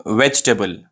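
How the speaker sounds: "वेजिटेबल" shortened to VECH-tuh-bul; correct pronunciation. Shown as a demonstration. Teacher introduces the next word, "वेजिटेबल" as vech-tuh-bul. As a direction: drop the middle "i" in "वेजिटेबल". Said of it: "सही pronunciation है".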